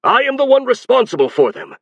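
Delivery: high, loud shout. Acted. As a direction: shout it with full force. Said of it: "Azen shouted".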